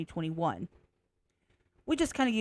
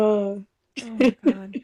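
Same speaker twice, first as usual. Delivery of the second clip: echo effect; 7 dB louder, much slower than usual. Acted.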